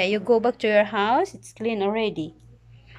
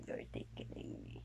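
breathy whisper while tickled; whispered giggle; 21 dB quieter than usual. Real, not acted.

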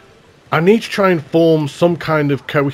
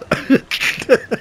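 sheepish laugh; 9 semitones higher than usual. Real, not acted. Embarrassed at lost words.

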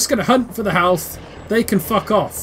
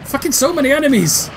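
frustrated shout; 6 semitones higher, 3 dB louder than usual. Real, not acted. Swarmed by enemies in game.

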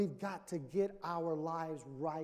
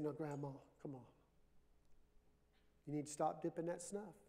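quiet; 3 semitones lower, 8 dB quieter than usual. Retold or imitated. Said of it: "really quiet".

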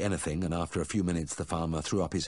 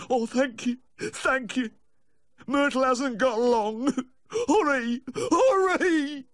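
high wail building to a loud cry; sobbing plea; 5 dB louder, much slower than usual. A narrator's reading. Owner begging the vet to hurry to Myrtle, sobbing. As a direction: thank through tears, then wail urgently. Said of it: "pleading between sobs".

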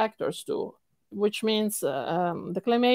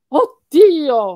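Oddio burst out loud, doubled D as in Od-dio; passionate Italian exclamation; 4 semitones higher, 11 dB louder than usual. Shown as a demonstration. Teacher showing a student how Italians exclaim, loud and passionate. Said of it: "and we say".

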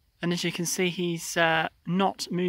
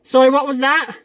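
loud, higher request to repeat; projected, questioning radio voice; radio query; 6 semitones higher, 9 dB louder than usual. Real, not acted. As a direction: call back into the radio for a repeat. Asks pilot to repeat over radio.

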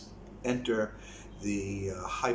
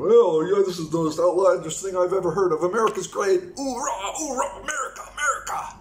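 loud, high mocking patriot voice; loud gung-ho soldier; 8 semitones higher, 9 dB louder than usual. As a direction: yell it like a flag-waving troll. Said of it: "I'll see some trolls, they'll come on over and respond".

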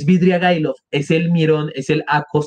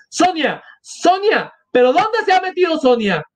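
high-pitched call for someone missing; acted frantic cry; 13 semitones higher than usual. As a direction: call out high, searching for her. Widow calling out for Sonia.

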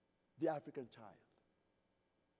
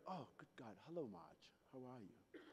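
much softer, low prim British hello; prim British reply; 5 semitones lower, 17 dB quieter than usual. Retold or imitated. Young [name] replying to Marge, soft prim British. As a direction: murmur it like a startled proper Brit. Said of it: "And I'm like".

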